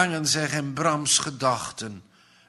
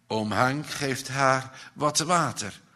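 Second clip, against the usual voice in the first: ordinary delivery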